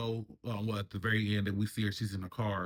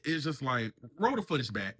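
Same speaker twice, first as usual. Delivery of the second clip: normal talking voice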